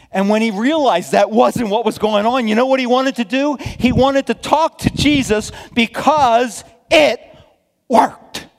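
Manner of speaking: shouted sermon emphasis; preacher shout. Real, not acted. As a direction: proclaim it with full force.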